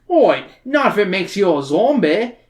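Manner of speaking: put-on cheeky Australian-accented bloke. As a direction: tease him in a jokey Australian accent. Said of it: "great Australian accent like I can".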